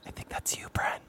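hushed prompt to a friend; whispering. Real, not acted. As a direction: whisper it aside.

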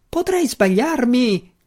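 high, slow, squealing backpedal; panicked wizard. A narrator's reading. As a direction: squeal it in sudden fright. Frightened wizard takes it back.

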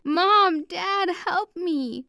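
put-on girl faking terror while kidnapped; mock scream for help. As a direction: scream for your parents in staged terror. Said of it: "I screamed".

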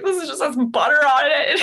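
high, tearful complaint through laughter; laughing mock crying. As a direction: whine it, half laughing. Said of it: "I was, like, crying".

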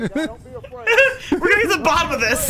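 laughing while saying it. Real, not acted. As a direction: crack up mid-line. Host laughing through a quoted line.

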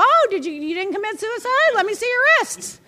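high, loud mock-alarmed cop; imagined cop. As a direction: play a nosy cop with comic urgency. Acts out cop on street.

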